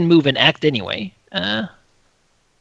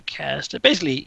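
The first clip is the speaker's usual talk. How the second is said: normal talking voice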